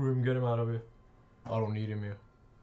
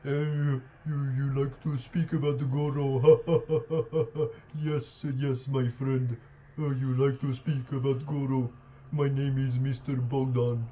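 slow, hammed-up Bulgarian accent; lightly mocking Bulgarian accent, laughing; imitating a Bulgarian player's accent to mock him; mock Eastern European accent; slower than usual. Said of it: "I can just tell how he sounds".

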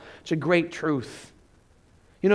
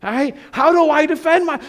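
mock panic; 8 semitones higher, 4 dB louder than usual. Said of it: "he was freaking out".

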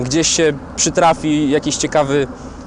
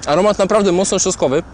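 mouth full; 2 semitones higher, 3 dB quieter than usual. Real, not acted. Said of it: "Przepraszam, że mówię z pełną buzią".

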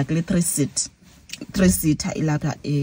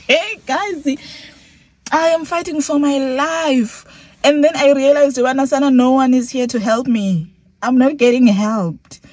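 raised, high-pitched appeal for help; acted desperate plea; 7 semitones higher, 3 dB louder than usual. Robbed passenger appealing to others for help, acted desperate plea. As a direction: plead urgently in a mock-desperate high voice.

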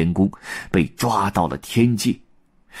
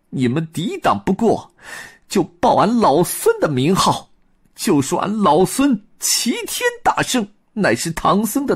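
high Monkey King, earnest instructions; proud monkey hero telling his brothers to use his name; high Monkey King voice giving orders; 8 semitones higher than usual. Acted. Sun Wukong instructs brothers.

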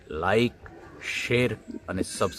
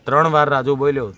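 loud, higher angry retort; angry shout; 4 semitones higher, 10 dB louder than usual. Retold or imitated. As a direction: snap back loudly. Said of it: "ઓલો ખીજાણો ત્રણ વાર બોલ્યો". (ગુસ્સાથી જોરથી)

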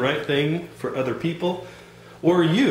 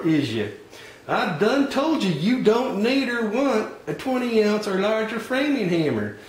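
higher, folksy Southern salesman voice; folksy hardware clerk; 6 semitones higher than usual. Acted. Clerk pushing the wrong tools on a customer, folksy higher voice. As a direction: play the pushy country store clerk.

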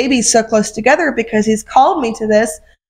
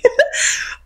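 breathy laugh; 11 semitones higher, 4 dB quieter than usual. Real, not acted. Laughs at own jealousy.

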